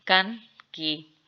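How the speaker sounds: कन, की stretched slowly; कन की sounded slowly; slow syllable demo. Shown as a demonstration. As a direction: draw out कन, की. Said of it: "अच्छा, ब्रो".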